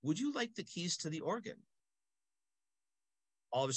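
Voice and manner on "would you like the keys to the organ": kindly priest. Retold or imitated. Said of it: "he says".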